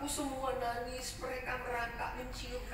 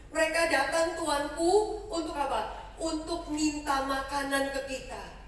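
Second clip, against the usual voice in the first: servant's report; 7 dB louder than usual. Acted. Servant explains beggars' request.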